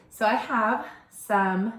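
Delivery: normal talking voice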